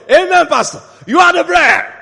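high, loud mimicked cheering; mock-adoring shout to the pastor; excited congregation. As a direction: shout it like an over-eager church crowd. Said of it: "they were saying".

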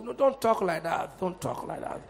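low, hushed reproof; too-civilized objector. Acted. Civilized churchgoer hushing an outspoken believer, low mock reproof. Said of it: "They were too civilized. That's the way some of us do".

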